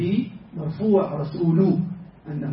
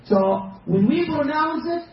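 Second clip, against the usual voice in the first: ordinary talk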